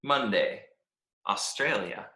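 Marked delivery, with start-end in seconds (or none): none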